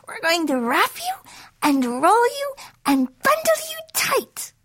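very high, swooping teasing voice; playful goddesses. A narrator's reading. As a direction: tease in high little voices, climbing as you go. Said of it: "three giggling goddesses".

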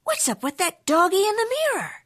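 puzzled, high voice; high puzzled question. Acted. Skippy puzzled at reflection.

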